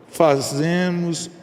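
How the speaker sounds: normal talking voice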